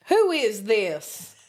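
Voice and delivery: slow, loud Southern kid imitation; drawn-out Southern phone demand; Southern accent. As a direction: imitate a Southern boy, dragging the words. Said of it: "in his southern accent".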